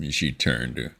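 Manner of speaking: plain reading voice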